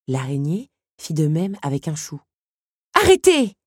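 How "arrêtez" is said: high, loud outraged cry; sharp burst of indignation; indignant. A narrator's reading. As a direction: shout it in protest to stop them. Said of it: "s'indigna Belle".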